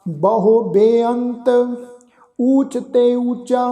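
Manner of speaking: slow, drawn-out scripture chant; slow devotional recitation of the verse. Shown as a demonstration. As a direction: recite each word slowly and reverently.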